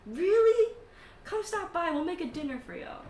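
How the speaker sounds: high, excited invitation; delighted host. Acted. Elf inviting friends to dinner, delighted. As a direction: burst out high, then invite eagerly.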